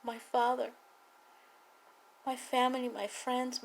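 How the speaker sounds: ordinary delivery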